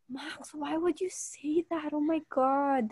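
soft, slow mock dismay; hushed shocked friend. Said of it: "she was like".

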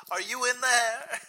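put-on loud, high New York accent yell; New York wife yelling. Mobster's wife yelling for her husband at a house, loud New York accent. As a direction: holler it through the door like a Bronx wife. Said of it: "thick New York accent yelling at him".

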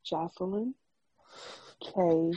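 soft, halting pace with long pauses; slow, careful name. Real, not acted. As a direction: say each name slowly and quietly, pausing between. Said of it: "say it slow today".

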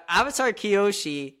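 usual speaking voice

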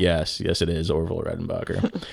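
deadpan confirm. Retold or imitated. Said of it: "I'm like".

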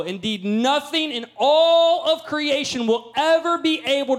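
rising preacher's read of Romans; preaching scripture. Acted. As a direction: preach it up to full force. Reads Romans building to a peak.

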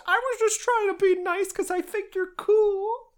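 very high squeaky hurt protest; whiny protest. Retold or imitated. Petya tells Dolokhov he was being nice, whiny.

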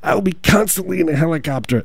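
high-pitched mock politician's vow; Bernie Sanders. Politician vowing to live in a helicopter, high put-on voice. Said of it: "He's like, he's like".

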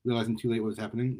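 usual speaking voice

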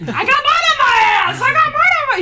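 loud, high, gleeful shout; imitating the kid yelling about mud. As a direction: holler it to everyone. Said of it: "he was just, like, yelling".

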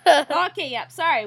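loud. Real, not acted. Wrapping up over dog noise.

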